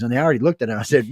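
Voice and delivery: ordinary delivery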